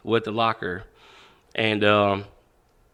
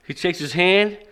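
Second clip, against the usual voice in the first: ordinary delivery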